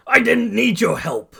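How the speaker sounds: high, indignant shout; yelling. A narrator's reading. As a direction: yell it back at your rescuer. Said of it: "Bakugo yells".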